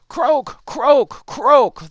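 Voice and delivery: three loud frog croaks; calling croaks. A narrator's reading. Frog calling to the unnoticing ox, loud croaking. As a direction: call out each croak loudly, separately. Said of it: "the frog called".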